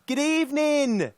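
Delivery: put-on thick English accent, high and drawn out. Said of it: "in a thick English accent".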